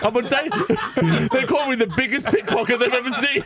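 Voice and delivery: high, laughing mock street urchin. Pickpocket boasting of his fame, high urchin voice. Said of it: "common little street urchin".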